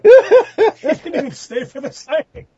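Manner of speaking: high, loud laughing delivery; real laughter breaking through the punchline. Real, not acted. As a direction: say it while cracking up. Cracking up over his own anecdote.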